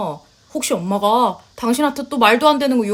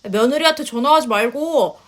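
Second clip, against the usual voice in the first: normal talking voice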